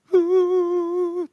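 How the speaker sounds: high, drawn-out fake sob; imitated wife's wail; hammed-up weeping noise; mock sobbing. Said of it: "Jangan nangis".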